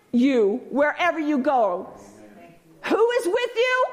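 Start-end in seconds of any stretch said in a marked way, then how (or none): 2.8-3.9 much higher ringing question; preacher's call. Acted. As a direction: call it out to the room.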